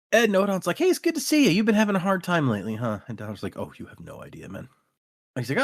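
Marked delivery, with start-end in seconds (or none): 0.8-3.0 high, quick friendly character voice; chirpy retold greeting. Noadon greeting troubled Dalinar, high chirpy voice. 3.6-4.7 low, very soft reply; weary aside. Retold or imitated. Dalinar answering Noadon about hard times, low soft weariness.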